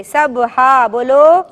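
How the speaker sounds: سبحہ said very slowly, clear ح sound; slow pronunciation. Shown as a demonstration. Teacher drills the name slowly for the children. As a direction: spell سبحہ out syllable by syllable. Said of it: "حلوے والے ہے سے".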